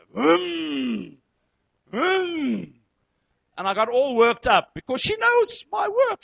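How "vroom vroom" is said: long high revs that fall away; imitated car engine. Husband imitating the car he heard outside, long revving noise. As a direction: vroom like an impatient driver revving. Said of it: "I heard the scar outside here".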